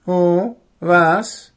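high startled owl waking up; groggy owl. Acted. Owl startled from sleep, confused. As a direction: jolt awake confused.

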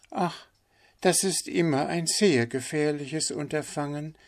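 high, put-on duck voice; knowing duck. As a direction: warn knowingly, pitched high. Figure of the duck. (wissende Ente, hohe verstellte Stimme)

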